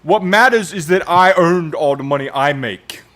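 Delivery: hammed-up defensive bluster; mock self-justifying capitalist. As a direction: insist loudly, playing the indignant rich man. Acting out the socialist turned capitalist.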